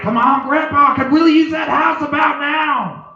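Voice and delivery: high, loud call to grandpa; acted shouted appeal; impatient plea. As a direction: call out, pleading and pushy. Calling out to dead grandpa.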